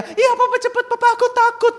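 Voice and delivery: put-on very high, loud little-boy wail; pleading child. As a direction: beg dad in a hammed-up toddler voice. Son begs to be lifted.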